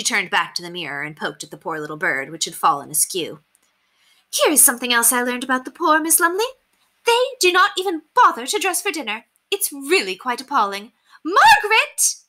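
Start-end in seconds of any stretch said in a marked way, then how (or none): 4.3-10.9 high, theatrical society-lady voice; haughty lady, appalled. A narrator's reading. Lady telling governess of shocking paupers, haughtily appalled. Narrator plays Lady Constance. 11.2-12.2 loud, very high screeched summons; impatient screech. A narrator's reading. Lady summoning her housemaid, impatient screech. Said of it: "she screeched impatiently".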